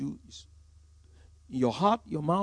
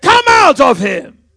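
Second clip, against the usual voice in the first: a slow, much louder and higher command; commanding shout; 9 semitones higher, 19 dB louder, slower than usual. Retold or imitated. Jesus casting the spirit out of the boy, a slow commanding shout. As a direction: drag out each word with full force.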